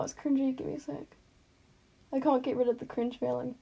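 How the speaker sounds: ordinary delivery, nothing marked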